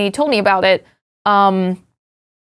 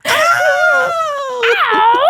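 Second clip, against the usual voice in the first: rock yelp. Pretend. Rock singer yelp bit.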